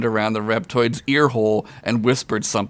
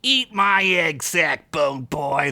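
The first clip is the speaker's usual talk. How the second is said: rude reptoid voice; 5 semitones higher, slower than usual. Acted.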